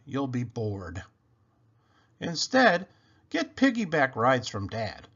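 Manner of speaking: ordinary delivery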